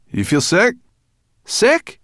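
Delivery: high, loud questions; calling out. A narrator's reading. Sorrel asking Ben in flight, calling out. Shouted exchange during flight.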